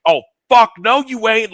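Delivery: loud, high mock outrage; furious bride. Bride blocking the dad charging back in, furious.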